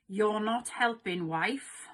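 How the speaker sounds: usual speaking voice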